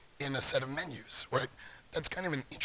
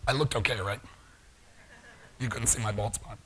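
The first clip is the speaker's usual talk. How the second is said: ordinary talk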